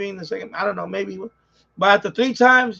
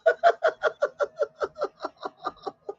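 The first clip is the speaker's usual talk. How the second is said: high laugh; 3 dB quieter than usual. Real, not acted. Laughing at his own joke.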